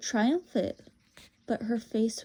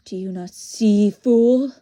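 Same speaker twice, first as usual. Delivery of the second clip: loud, berating witch voice; exasperated witch; 8 dB louder than usual. Acted. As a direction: hammer the point at a foolish boy, loudly.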